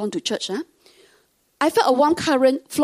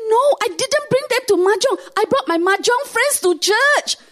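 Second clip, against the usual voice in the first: high, louder, laughing correction; indignant mom; 12 semitones higher, 5 dB louder than usual. Retold or imitated. Mom corrects her daughter, high and indignant. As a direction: set the record straight with gusto. Mom's comeback.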